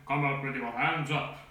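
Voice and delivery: imitated megaphone command; megaphone shout. A narrator's reading. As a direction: bark orders as if through a megaphone. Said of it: "shouted through his megaphone".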